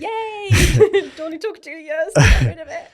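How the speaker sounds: loud, high laughing cheer. Real, not acted. Celebrating with the host, a loud laughing cheer. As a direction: whoop with relief through laughter. Celebrating phantom crying gone.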